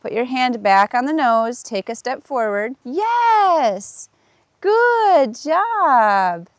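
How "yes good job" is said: high, bright cheer for the horse; pitched-up delighted praise; excited praise. Real, not acted. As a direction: celebrate the step. Horse steps forward on cue.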